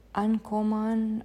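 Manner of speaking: ordinary delivery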